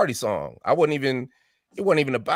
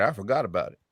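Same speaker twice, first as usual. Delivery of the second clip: usual speaking voice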